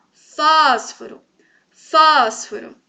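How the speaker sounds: FÓSforo, first syllable long and loud; slow demo of FÓSforo, foro falling away; stress on FÓS. Shown as a demonstration. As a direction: lean hard and slowly on FÓS. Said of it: "O fósforo é a antepenúltima".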